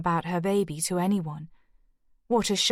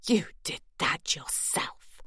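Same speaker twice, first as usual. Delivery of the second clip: hushed, unvoiced whisper; thick with emotion; 3 semitones lower, 6 dB quieter than usual. A narrator's reading. Morag accusing the villain in a choked whisper. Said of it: "Morag's voice was thick with emotion".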